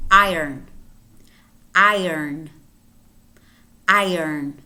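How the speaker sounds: iron as EYE-urn, firm onset; like "I earn". Shown as a demonstration. Teacher drills it for learners, iron as EYE-urn. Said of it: "Similar to I earn a lot of money".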